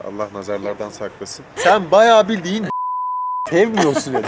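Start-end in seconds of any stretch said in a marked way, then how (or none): 1.5-4.2 teasing. Real, not acted. Teasing, slow, high and loud.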